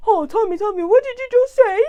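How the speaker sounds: high, loud mock fascination; eager interest. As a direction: imitate gushing curiosity, voice shooting up. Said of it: "everybody's gonna be interested in what".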